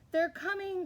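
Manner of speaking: high, excited lookout's call. A narrator's reading. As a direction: shout the news down from up high. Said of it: "called Puss".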